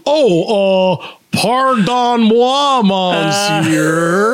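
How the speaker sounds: high, very drawn-out mock French; hammed-up French accent, stretched slow; imitated French apology. Stranger bumped in a Quebec hallway, mock French. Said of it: "the guy goes".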